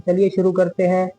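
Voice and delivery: ordinary talk, nothing marked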